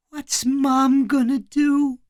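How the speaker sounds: put-on high, slow, tiny child voice; thin little-boy voice, pitched high; barely above a whisper. A narrator's reading. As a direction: ask it small and fearful. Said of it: "in a voice only slightly above a whisper".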